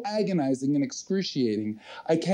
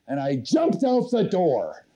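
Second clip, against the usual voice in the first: usual speaking voice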